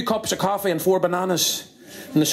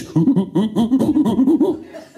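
short monkey hoots climbing higher; monkey hooting; 5 semitones higher, 4 dB louder than usual. Acted. Monkey telling what the third brother did, rising hoots. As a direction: hoot in small rising bursts. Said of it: "And the monkey says".

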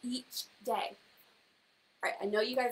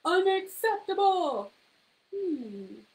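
stuffy disapproval; 5 semitones higher, 5 dB louder, slower than usual. Acted. Disapproving animal friend reacts.

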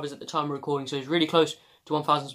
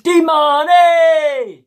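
long, drawn-out shout of the name, much higher and louder; Vizza's shout; 12 semitones higher, 11 dB louder, much slower than usual. Retold or imitated. Vlogger imitating Vizza yelling the player's name, drawn-out and loud. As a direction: bellow the player's name like a fan in the stands. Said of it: "here's an impression of lads".

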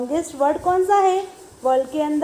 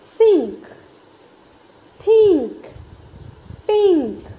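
loud, higher, one word at a time; saying aloud; 4 semitones higher, 6 dB louder, slower than usual. Shown as a demonstration. Teacher drilling words aloud for the class, loud. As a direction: call each word out firmly for repeating. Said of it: "आपको ज़ोर से ये बोलना है".

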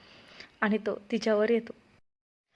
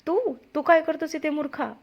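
pitched up, startled yell at the servant; startled shout; 6 semitones higher, 3 dB louder than usual. A narrator's reading. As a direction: yell in shock at the intruder. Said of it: "अजूनच जोरात ओरडला".

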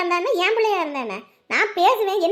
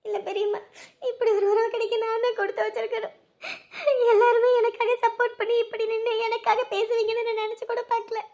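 high, softer crying voice; tearful; 4 semitones higher, 3 dB quieter than usual. Acted. Girl grateful that her aunt stood up for her, crying. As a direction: thank her through tears, pitched high. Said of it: "நீ எதுக்கும்மா அழுகுற?".

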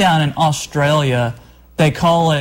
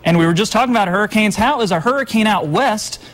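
upbeat, higher-pitched weathercast delivery; TV weather report; 3 semitones higher than usual. Real, not acted. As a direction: present the forecast to viewers, lifting key words. Presents the TV weather forecast.

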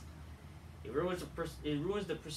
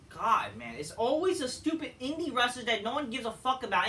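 ordinary talk, nothing marked

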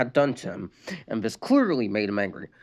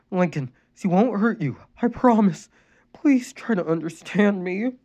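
reassuring; 8 semitones higher than usual. A narrator's reading. Mother reassuring son.

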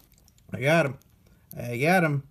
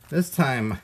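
usual speaking voice

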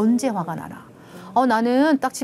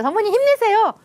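higher, louder bright encouragement; cheerful cheer; 12 semitones higher, 5 dB louder than usual. Retold or imitated. Employee cheering on a tired executive, bright and loud. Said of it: "화내시더라고요".

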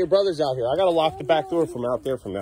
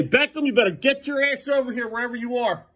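much higher, louder angry yell; angry call; 10 semitones higher, 3 dB louder than usual. Real, not acted. Parent yelling for Beckham to come back, angry. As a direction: call him in, furious. Calling for runaway kid.

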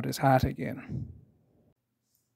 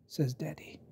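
plain reading voice

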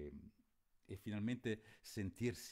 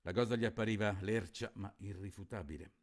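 steady literary reading of a novel; story narration; 2 semitones higher, 4 dB louder, faster than usual. A narrator's reading. Teacher reads Fenoglio to students, measured narration. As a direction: read it like a book to the class.

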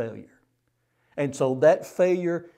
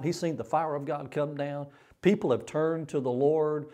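ordinary delivery

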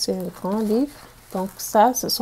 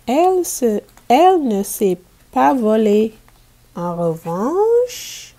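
animated read-aloud, louder than her chat; energetic storybook reading; lively reading to children; 3 semitones higher, 5 dB louder than usual. Acted. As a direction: bring the ocean scene to life for the class. Teacher reads picture book aloud.

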